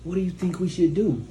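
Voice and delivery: lightly mocked tentative question; a hint of a leaderless man; indecisive man. Weak man looking to her for leadership, mildly mocking. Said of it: "looking at you for damn leadership".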